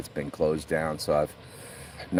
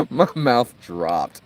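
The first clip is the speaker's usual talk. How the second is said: laughing; 6 semitones higher, 6 dB louder, much slower than usual. Real, not acted. Retelling shocking livestream moment.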